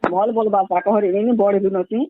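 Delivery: ordinary talk, nothing marked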